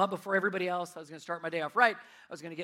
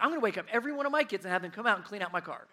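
rushed threat; much faster than usual. Retold or imitated. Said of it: "I almost was like".

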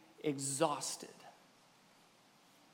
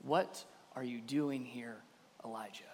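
gentle whisper; 9 semitones lower, 12 dB quieter than usual. A narrator's reading. God speaking to Elijah at the cave, hushed. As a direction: ask it hushed, fading on the name. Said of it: "whispered to him".

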